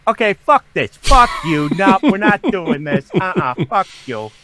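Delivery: high, loud screamed refusal; retold screaming. Storyteller replaying his scream at the tea machine, high loud refusal. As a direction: scream it high, easing off at the end. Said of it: "which is scream".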